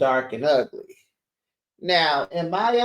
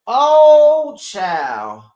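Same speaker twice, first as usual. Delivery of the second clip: a loud, much higher cry of "Oh"; shocked exclaim; 6 semitones higher, 9 dB louder than usual. Real, not acted. Host reacting to the episode's end with a loud shocked cry. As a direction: let the shock burst out.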